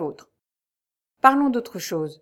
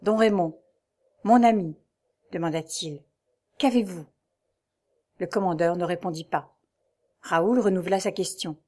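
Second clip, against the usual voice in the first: ordinary delivery, nothing marked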